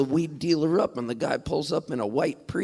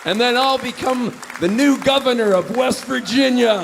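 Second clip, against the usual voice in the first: high, loud proclamation; hammed-up politician's announcement; mock rally speech; 10 semitones higher, 7 dB louder than usual. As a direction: proclaim it to a cheering crowd. Mock campaign speech to the crowd.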